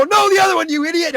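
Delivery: high mock-scolding kids; kids correcting. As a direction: tease the clown as the kids. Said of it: "They go".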